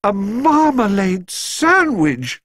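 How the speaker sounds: marmalade sandwich exclaimed high, very slow, amazed; amazed exclamation. A narrator's reading. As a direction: marmalade sandwich stretched out in delighted surprise. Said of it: "exclaimed Missus Brown".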